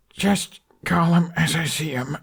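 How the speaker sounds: a touch of a pained gasp. A narrator's reading. Punched captive Hiccup, gasping a defiant retort. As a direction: get it out through a gasp. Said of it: "Hiccup gasped".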